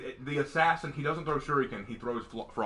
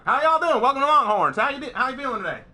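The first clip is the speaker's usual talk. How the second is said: imitating the boss in a higher, louder Southern accent; the boss welcoming guests at Longhorns in a Southern accent; 7 semitones higher, 4 dB louder, faster than usual. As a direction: greet the guests like a Southern restaurant host with a Southern accent. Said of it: "but he has that accent".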